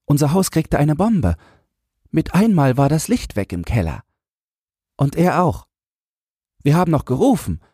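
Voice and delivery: put-on high, earnest child's voice; grieving little boy. A narrator's reading. Boy tells of his buried little brother, earnest. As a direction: tell the loss as a small boy would.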